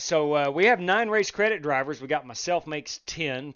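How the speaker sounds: normal talking voice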